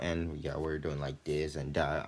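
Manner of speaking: ordinary talk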